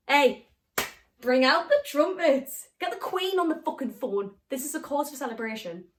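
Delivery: mock triumph. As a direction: proclaim it like a sarcastic herald. Mocking captor's bit of intelligence.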